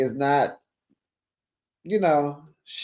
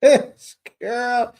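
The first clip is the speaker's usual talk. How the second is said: laughing; 8 semitones higher, 4 dB louder than usual. Real, not acted.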